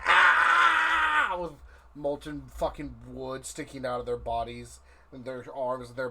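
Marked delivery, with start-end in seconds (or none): 0.1-1.4 high shrieking cry of pain; pained scream. Acted. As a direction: scream as if burned. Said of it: "people are like".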